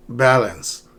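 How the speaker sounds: "Balance" stressed on the first syllable. Shown as a demonstration. Teacher repeating the model for learners, "Balance" with first-syllable stress. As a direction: hit the first syllable of "Balance", falling away after.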